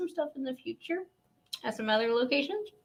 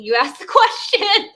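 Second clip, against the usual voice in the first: higher, louder, slower, laughing through it; laughing praise; 11 semitones higher, 8 dB louder than usual. Retold or imitated. Mocking her own habit of asking herself questions, laughing. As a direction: praise yourself while cracking up. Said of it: "And I'm like".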